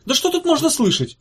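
high, shrill-pitched yell. Acted. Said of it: "завопил связной Света".

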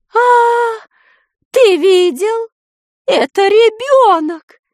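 exclaiming. A narrator's reading. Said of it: "воскликнула Нинель".

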